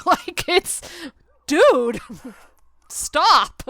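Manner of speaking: high and loud, laughing as she protests; laughing exasperation. Real, not acted. Laughing at the preview, a loud exasperated plea. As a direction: laugh it off in disbelief.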